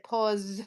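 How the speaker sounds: "Pause" with the S held at the end; low, careful "Pause" with its last S; final S sounded. Shown as a demonstration. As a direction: say "Pause" and finish on a clear S. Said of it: "Add the S at the end".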